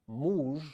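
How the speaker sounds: muzh with a pure u; Russian muzh. Shown as a demonstration. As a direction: muzh said with a tight u, no glide. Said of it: "Or we have".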